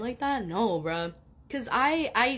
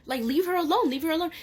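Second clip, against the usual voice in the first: higher and louder, telling him off; furious retold yell; 8 semitones higher, 3 dB louder than usual. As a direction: yell at a creep to leave her alone. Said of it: "And I was like".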